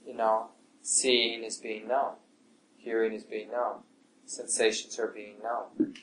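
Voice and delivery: quietly note each experience as it comes; a hushed guided-meditation noting voice; soft meditation noting. Shown as a demonstration. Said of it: "if you like that phrase".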